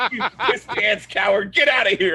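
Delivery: hammed-up insult hurling; scornful mock yell. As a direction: imitate someone angrily chasing him off. Retold insults hurled at Walt.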